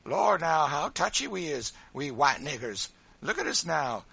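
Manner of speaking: high, loud teasing; boisterous, goading character voice; mocking Sambo. A narrator's reading. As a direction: jeer at someone touchy.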